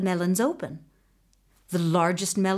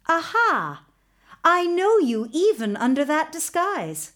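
higher-pitched, mildly triumphant "got you"; triumphant discovery; 6 semitones higher than usual. A narrator's reading. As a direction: catch someone out, pitched up. Princess triumphantly sees through disguise.

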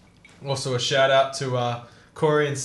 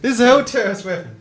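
loud, high-pitched exclamation; shocked excitement; 8 semitones higher, 9 dB louder than usual. Real, not acted. Friend joking about the alarming can, shocked excitement. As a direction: blurt it out in giddy alarm.

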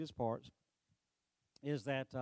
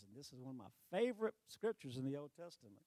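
normal talking voice

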